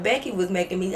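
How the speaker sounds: normal talking voice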